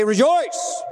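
high, rising "Rejoice"; hammed-up order to be joyful; mock command. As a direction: pretend to order someone to cheer up. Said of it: "how in the world can you command somebody to rejoice".

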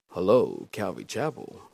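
low, soft phone greeting. Shown as a demonstration. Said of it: "That's my phone voice".

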